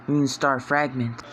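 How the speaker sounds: ordinary delivery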